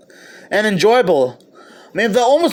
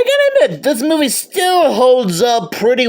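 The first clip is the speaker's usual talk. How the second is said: laughing; 6 semitones higher than usual. Real, not acted. High laughing admission.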